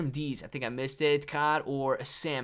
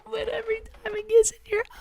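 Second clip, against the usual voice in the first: very high, laughing voice; laughing punchline; 5 dB louder, faster than usual. Real, not acted. Streamer laughing at their own salt joke, high. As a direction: squeak it out laughing.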